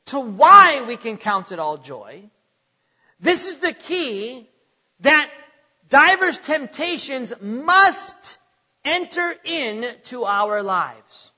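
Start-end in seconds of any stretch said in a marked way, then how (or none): 5.9-8.4 slow build to a loud, held "must"; stress on MUST. Real, not acted. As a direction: lean hard on "must", then pause. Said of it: "Notice I said must".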